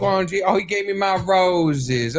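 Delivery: hammed-up, high-pitched birthday gratitude; gushing thanks. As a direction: gush as if handed a bouquet.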